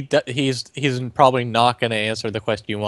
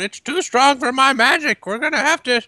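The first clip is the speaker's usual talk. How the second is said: high, raised yell to the party; panicked yell; 5 dB louder than usual. Acted. Wizard warns the party the magic is failing, high yell. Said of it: "like, yell out".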